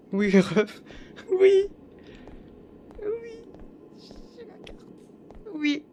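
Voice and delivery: very high, thrilled cries; triumphant squeal. Real, not acted. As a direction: squeal with victory. Victoire, cris aigus. (cris de victoire très aigus)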